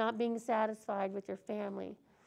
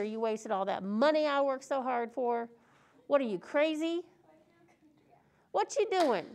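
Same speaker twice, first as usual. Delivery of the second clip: mock angry rant, a bit louder; scolding father; 4 dB louder than usual. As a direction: chew him out like a fed-up dad. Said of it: "he didn't say".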